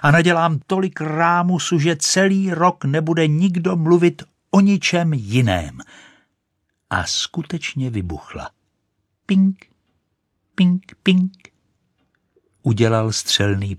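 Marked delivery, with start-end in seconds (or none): 0.0-5.8 high, loud grandiose threat; sustained exultant yell; shouting. A narrator's reading. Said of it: "křičela". 9.3-11.5 high, loud short pings; small explosion imitated in high pitch; high pinging noises. A narrator's reading. Sound of the rocket exploding.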